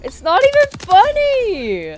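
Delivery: high, loud, slow laughing protest. Real, not acted. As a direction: protest while cracking up. Teammate cracking up while protesting.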